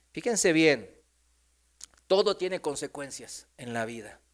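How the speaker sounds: normal talking voice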